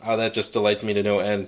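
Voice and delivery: ordinary talk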